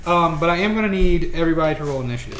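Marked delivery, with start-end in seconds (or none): none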